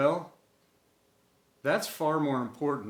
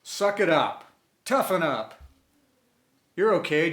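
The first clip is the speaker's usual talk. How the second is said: a touch louder, brisk no-nonsense dad; mock-bark it like a dad telling a kid to shake it off; lightly voiced dad: suck it up; tough-love dad; 3 semitones higher, 5 dB louder than usual. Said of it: "hear him say to us".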